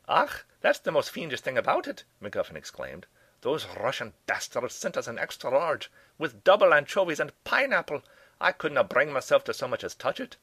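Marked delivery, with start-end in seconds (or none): 0.1-2.0 Scottish professor. Acted. Old Scots professor tells the detective about the pizza, in a high, loud Scottish brogue. MacGuffin exclaims. 3.4-10.4 Scottish professor. Acted. Old Scots professor tells the detective about the pizza, in a high, loud Scottish brogue. MacGuffin complains.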